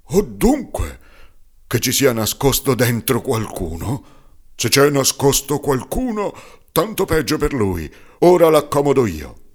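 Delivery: put-on old carpenter's loud angry threat. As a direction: bluster to drive away fear. Old man threatening hidden someone.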